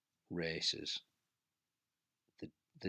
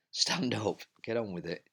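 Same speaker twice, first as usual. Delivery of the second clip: self-urging shout; 4 semitones higher, 7 dB louder than usual. Real, not acted. Urging himself up the climb.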